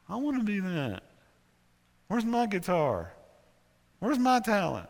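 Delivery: high, mock-jealous whine; envious whining. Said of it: "I couldn't stand him".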